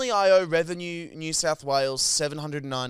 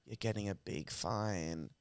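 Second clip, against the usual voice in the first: low, slow and much softer mock official; a hint of a deadpan officer; mocking his voice; 7 semitones lower, 13 dB quieter, slower than usual. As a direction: drone it out like a smug cop. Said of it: "He just sounded like a fucking".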